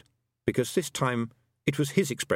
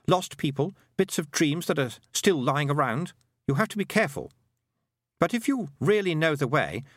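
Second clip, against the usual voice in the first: put-on higher-pitched boy's voice; a boy explaining earnestly, pitched up; boy character, earnest; 6 semitones higher than usual. A narrator's reading. Roland warns the girl about the forest, earnest high boy.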